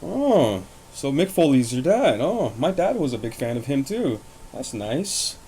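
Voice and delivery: high, swooping mock-polite girl voice; exaggerated high-pitched imitation of a girl; imitated girl. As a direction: put on her reaction, pitched high and singsong. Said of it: "then she's just like".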